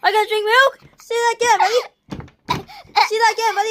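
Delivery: loud high mock bully taunt; belligerent drunk. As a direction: swagger and jeer, loud. Tough-guy taunt continues.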